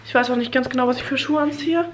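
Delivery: normal talking voice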